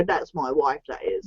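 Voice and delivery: low, soft mock-manly claim; manly possessive husband. Friend suggesting how to claim his wife, mock manly. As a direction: drop it down and quiet, playing the manly husband. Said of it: "makes you feel manly. You can go".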